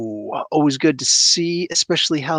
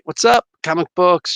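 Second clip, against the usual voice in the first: ordinary talk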